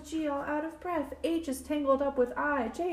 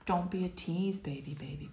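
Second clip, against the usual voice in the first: whisper; 5 semitones lower, 5 dB quieter than usual. Acted. Refrain whispered in story.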